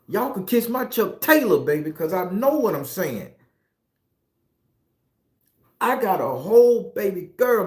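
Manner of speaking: ordinary delivery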